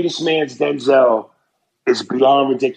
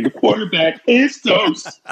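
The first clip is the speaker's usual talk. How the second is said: mock scream; 7 semitones higher than usual. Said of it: "you gotta scream".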